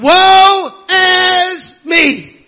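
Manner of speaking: very slow, loud, drawn-out lament; crying woe. Retold or imitated. Isaiah crying out before God, a slow loud lament. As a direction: stretch each word into a cry of anguish. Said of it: "he cried".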